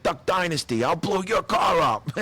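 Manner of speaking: hammed-up shouted threat; mock threatening shout. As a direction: yell the threat at the guy in the crowd. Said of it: "He's like".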